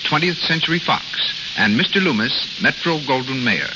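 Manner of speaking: usual speaking voice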